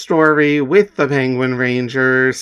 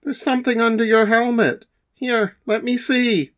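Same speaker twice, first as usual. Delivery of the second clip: concerned announcer; 4 dB louder, faster than usual. Acted.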